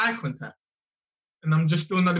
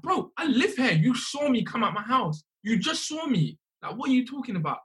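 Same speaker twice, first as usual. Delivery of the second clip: imitating an exasperated protest, pitched higher; retold exasperated retort; 7 semitones higher than usual. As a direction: push back in disbelief at the officer. Retold arguing with police officer.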